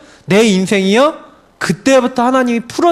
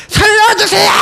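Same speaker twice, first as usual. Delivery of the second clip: high, urgent cry for help; desperate scream; 12 semitones higher than usual. Shown as a demonstration. Preacher demonstrates really crying out while drowning, desperate scream. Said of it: "막 소리 지르고 난리 나잖아요".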